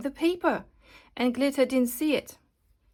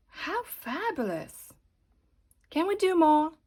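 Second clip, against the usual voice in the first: delighted diva; 5 semitones higher than usual. Acted. Glitter delighted with the glitter.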